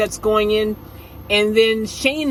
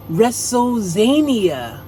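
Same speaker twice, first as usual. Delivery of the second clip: drawn out; much slower than usual. Real, not acted. Revealing the shirt's slogan to viewers, slow and drawn out. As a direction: stretch WrestleZania slowly, falling.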